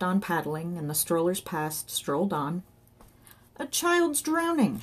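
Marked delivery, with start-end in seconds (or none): 3.6-4.8 high, drawn-out call; slow, high cry to raise the alarm; shouted alarm. A narrator's reading. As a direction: stretch it out as a distant shout. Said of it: "shouted Johnny".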